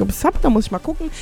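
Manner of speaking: ordinary talk, nothing marked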